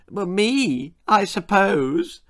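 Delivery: put-on boy confessing through suppressed laughter; howling. A narrator's reading. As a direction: barely hold the giggles in. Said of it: "howled Augustus".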